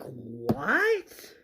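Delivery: disbelieving what, rising sharply; stunned rising question; incredulous. Retold or imitated. Retelling own incredulous reaction.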